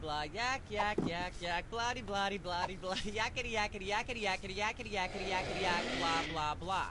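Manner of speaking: the rant trailing off very soft into blah blah; a soft droning ramble; fading rant. Acted. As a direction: mumble the filler words far under the voice. Host rant fades into blah.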